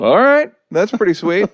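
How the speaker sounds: retold high, rising "well, well" reaction; pleased surprise. Retelling his own pleased reaction.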